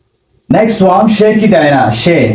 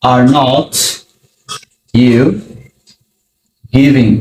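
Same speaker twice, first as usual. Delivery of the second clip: every word set apart by long pauses; slow word by word; 2 semitones lower, much slower than usual. Shown as a demonstration. Teacher building the question for students, word by word. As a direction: spell it out slowly. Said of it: "তুমির সাথে হবে are".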